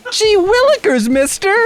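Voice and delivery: put-on high, gee-whiz kid voice; wholesome 1950s kid. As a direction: play an innocent old-timey boy. Mocking the meek victim voice.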